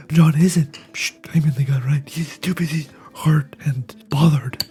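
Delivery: ordinary talk, nothing marked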